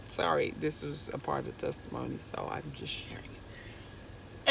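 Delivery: a hushed, embarrassed whisper; whispered aside. Real, not acted. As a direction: murmur it behind your hand.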